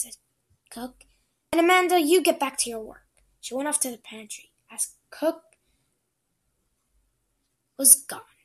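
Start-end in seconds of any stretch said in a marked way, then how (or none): 1.5-3.1 brisk order. Acted. Cook orders Amanda.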